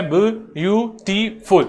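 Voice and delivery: बी यू टी फुल spelled letter by letter, slow; spelling out beautiful. Shown as a demonstration. Teacher spelling a word for the class, slowly and clearly. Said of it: "जैसे मेरे पास beautiful है".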